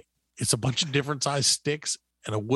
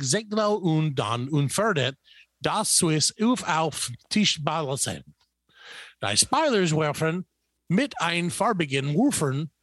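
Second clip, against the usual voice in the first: slow, drawn-out mock German ad read; confidently mangled German with a heavy American tongue; 3 semitones higher than usual. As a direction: read out pretend German product copy with confidence. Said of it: "because I speak flawless German".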